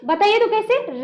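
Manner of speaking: ordinary delivery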